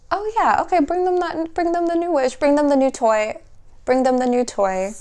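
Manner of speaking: high, fond voice easing back down; ancestors' voice. Retold or imitated. Reader voicing the ancestors handing the viewer a new toy, high and fond. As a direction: voice the ancestors offering a gift, high then settling. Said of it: "your ancestors are like".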